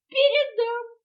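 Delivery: high, loud exclamation; exclaiming. A narrator's reading. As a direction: call it out high and brisk as a promise. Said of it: "воскликнул удав". (восклицая)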